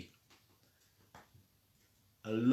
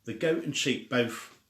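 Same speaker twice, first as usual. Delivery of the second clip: usual speaking voice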